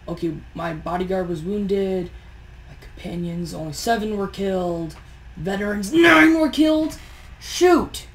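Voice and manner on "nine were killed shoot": shocked outburst. Real, not acted. Battle losses revealed in game.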